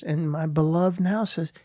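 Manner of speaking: usual speaking voice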